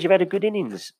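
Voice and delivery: a hint of a cheerful old man; old man voiced. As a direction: quote the old passenger kindly. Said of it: "He says".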